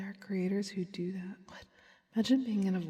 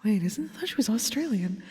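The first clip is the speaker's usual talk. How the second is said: loud, higher-pitched mock bafflement; retold confusion; 4 semitones higher, 11 dB louder than usual. Streamer retelling why she mistook a creator's country, exaggerated confusion. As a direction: re-enact your own confused reaction, much louder. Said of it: "That's why I was like".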